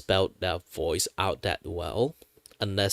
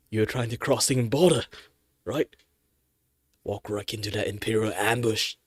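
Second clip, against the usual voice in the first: Skyrim soldier voice. Acted. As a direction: wake the prisoner with a shout. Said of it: "The Skyrim one".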